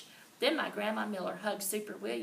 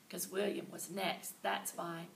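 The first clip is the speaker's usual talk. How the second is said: plain reading voice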